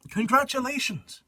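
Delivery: high, showy congratulations; grand congratulations. Acted. A prefect greeting new students, high and showy. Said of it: "should I do this in an English voice".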